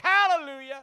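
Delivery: loud, high hallelujah; exultant praise shout. Real, not acted. Preacher exults in congregation.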